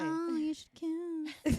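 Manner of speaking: slow, high teasing drawl. Real, not acted. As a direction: tease them, stretch it out. Teasing two friends.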